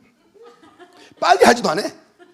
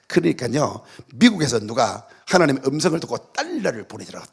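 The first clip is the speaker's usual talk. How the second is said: ordinary talk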